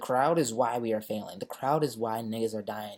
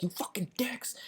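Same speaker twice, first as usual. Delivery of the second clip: mock angry curse; 10 semitones higher, 4 dB quieter than usual. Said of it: "He's like".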